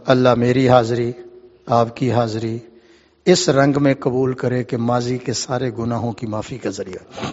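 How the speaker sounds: low, soft supplication; hushed, humble dua; closing prayer. Real, not acted.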